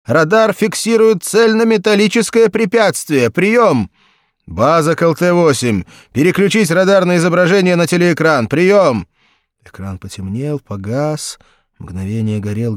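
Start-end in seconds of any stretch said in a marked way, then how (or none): 0.0-3.9 loud, high alarmed report; changed voice. A narrator's reading. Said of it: "совершенно изменившийся". 4.5-9.1 loud, high radio order; radio command. A narrator's reading. Base orders radar image.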